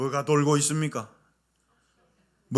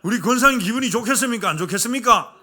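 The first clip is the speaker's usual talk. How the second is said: 권사님 asked again louder and faster; louder repeat; 5 semitones higher, 6 dB louder, faster than usual. Shown as a demonstration. Pastor repeating question louder to prompt congregation. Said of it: "좀 크게 대답해 주세요".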